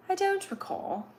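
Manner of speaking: high, soft playful voice; soft put-on voice. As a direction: say it softly and high, mock-delicate.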